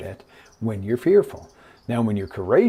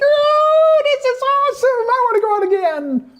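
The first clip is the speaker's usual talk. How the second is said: very high, loud hammed-up shriek of joy; thrilled squeal; 6 dB louder than usual. Rider with hands in the air, thrilled squeal. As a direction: whoop it out in a high falsetto.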